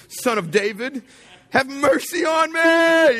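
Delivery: drawn-out, very high, loud yell. Acted. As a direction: bellow the plea, stretching the words high. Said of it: "shouted louder".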